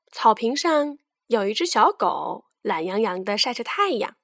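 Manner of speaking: plain reading voice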